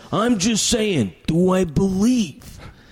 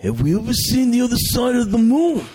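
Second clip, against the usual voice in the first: Macho Man voice; 6 semitones higher than usual. Retold or imitated. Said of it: "can you do your voice like Macho Man?".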